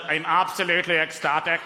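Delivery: put-on deadpan, monotone Northern Irish accent; downbeat Northern Irish accent. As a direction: claim joy glumly in a Northern Irish accent. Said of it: "sound a little bit downbeat".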